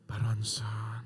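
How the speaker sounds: eerie whisper of Pak Ronson; ghostly whisper. Pretend. Ghost woman calls out to Pak Ronson, eerie whisper. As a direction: call his name like a kuntilanak ghost, whispering.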